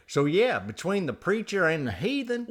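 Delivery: exaggerated folksy announcer; hammed-up show intro. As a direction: swing big into the catchphrase. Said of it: "do the whole show like that".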